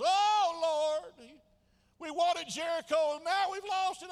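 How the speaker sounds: loud, high hammed-up wail; mock lament. Joshua crying to the Lord after defeat, hammed-up loud lament. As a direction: cry out to heaven in mock despair.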